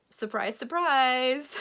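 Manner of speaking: mock surprise. Real, not acted. Ironic surprise after fears.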